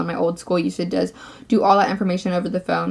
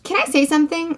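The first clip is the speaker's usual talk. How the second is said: higher repeat; 8 semitones higher than usual. Retold or imitated. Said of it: "this is the first word I've said".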